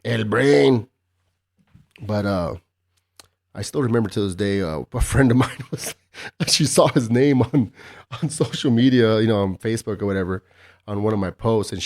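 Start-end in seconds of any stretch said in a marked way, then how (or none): none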